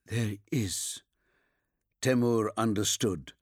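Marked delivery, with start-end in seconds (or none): 0.1-1.0 quiet, weary insistence; drained, low-energy soldier's reply; exhausted. Acted. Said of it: "He sounded exhausted".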